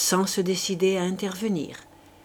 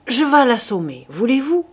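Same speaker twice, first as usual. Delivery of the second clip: rough eager offer; 5 semitones higher, 8 dB louder than usual. A narrator's reading. La poule affamée veut frapper.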